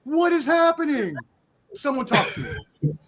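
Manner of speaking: ordinary talk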